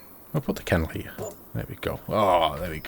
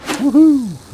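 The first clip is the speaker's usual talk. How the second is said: high, loud woohoo cheer; excited whoop; 9 dB louder than usual. Real, not acted. Gamer cheering his first fishing cast, high whoop.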